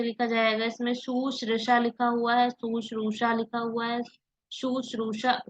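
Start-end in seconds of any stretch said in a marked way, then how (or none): none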